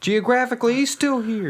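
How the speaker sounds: viel höher, gespielt naive Mutter; high mock mom voice.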